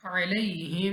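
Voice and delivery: अलैहिम recited with nasal ghunna on the मीम. Shown as a demonstration. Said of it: "यहाँ पे हम पढ़ेंगे गुन्ना के साथ".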